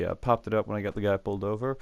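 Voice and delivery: ordinary delivery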